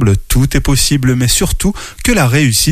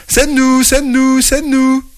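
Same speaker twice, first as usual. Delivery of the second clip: very high, thin chorus of little ants; many small voices crying out together; chorus of tiny voices; 12 semitones higher, slower than usual. Acted. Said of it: "cent mille petites voix qui crient". (chœur de petites voix aiguës)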